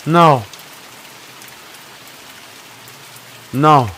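desperate yelling. Real, not acted.

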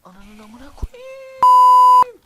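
panicked screaming curse, very high and loud, slow; terrified scream. Real, not acted. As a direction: scream it out in sheer fright. Jumpscare by a mob in game.

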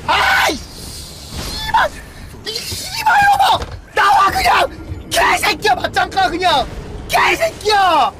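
fast, loud furious cursing; furious shouting. Real, not acted. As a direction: scream insults at the screen. Streamer raging at opponent.

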